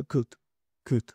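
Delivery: "cut" with a short, quick vowel; short vowel demo. Shown as a demonstration. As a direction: snap out "cut" fast. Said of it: "Es muy corto, muy rápido". ("cut" con vocal corta y rápida)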